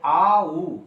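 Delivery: आ उ drawn out slowly, one sound into the next; slow, stretched आ उ; vowel glide demo. Shown as a demonstration. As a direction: hold आ, then move into उ. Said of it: "दो स्वर वर्णों का मिलन हुआ".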